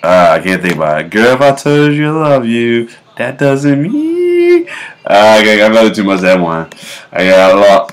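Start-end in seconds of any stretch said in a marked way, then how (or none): none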